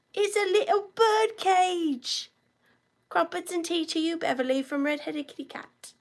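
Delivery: a playful hint of a British accent; mock British accent. Host teases a viewer in a mock British accent. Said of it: "when I do, I turn British".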